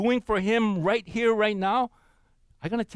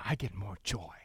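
soft, breathy whispered aside; hushed confiding whisper; 10 semitones lower, 10 dB quieter than usual. Real, not acted. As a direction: whisper it like a secret joy.

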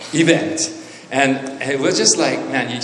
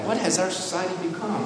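normal talking voice

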